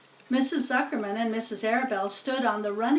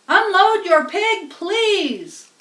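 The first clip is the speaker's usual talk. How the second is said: higher, louder PA command; pompous loudspeaker; 9 semitones higher, 9 dB louder, much slower than usual. Acted. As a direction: boom it out over the fairground. Loudspeaker orders the unloading.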